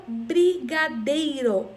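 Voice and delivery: slow "brigadeiro" in four beats, bri-ga-dei-ro; split into syllables. Shown as a demonstration. Teacher presenting a four-syllable word, "brigadeiro" beat by beat. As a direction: stretch each syllable of "brigadeiro". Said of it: "quatro sílabas".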